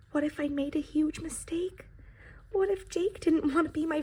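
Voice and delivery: anxious hushed whisper; worried whisper. Acted. Pie doubts itself, whispered worry.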